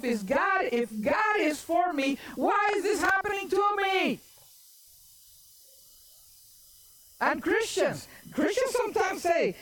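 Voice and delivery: high, urgent sermon delivery; impassioned preaching. Acted. Preacher voices discouraged Christians to the congregation, impassioned preaching. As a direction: preach it high, climbing into the anguished questions.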